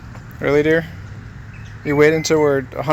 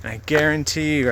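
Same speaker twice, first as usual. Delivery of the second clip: ordinary delivery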